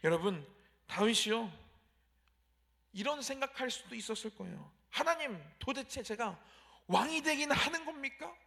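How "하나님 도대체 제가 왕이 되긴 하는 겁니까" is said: higher, louder plea of a frustrated man; a worked-up complaint to God; complaining prayer. Retold or imitated. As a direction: grumble at God, pleading upward. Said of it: "불평할 만도 해요".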